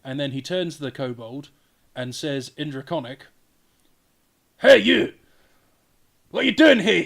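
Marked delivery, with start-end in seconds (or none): 4.6-7.0 higher, louder gruff call; dwarf yelling. Acted. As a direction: bark at the little kobold. Dwarf yells at kobold.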